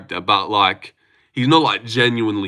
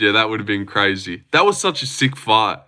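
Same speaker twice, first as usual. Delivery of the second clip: ordinary talk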